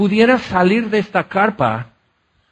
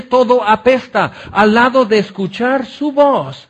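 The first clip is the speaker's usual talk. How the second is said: louder, higher, building sermon climax; fervent preaching; 3 semitones higher, 3 dB louder than usual. Acted. Preacher exalting Jesus' beauty to the congregation, fervent and loud. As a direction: pour it out to the congregation, rising in fervor.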